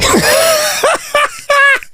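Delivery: high squealing laugh. Real, not acted. Joke teller squealing with laughter at his punchline.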